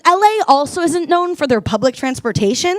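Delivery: ordinary talk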